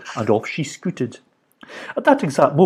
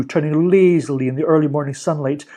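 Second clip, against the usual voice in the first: plain reading voice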